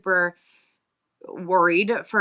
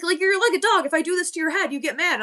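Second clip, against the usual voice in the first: higher-pitched imitated taunt; teasing son; 10 semitones higher, 3 dB louder than usual. Son messing with mom on the couch, playful taunt. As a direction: tease like a goading kid.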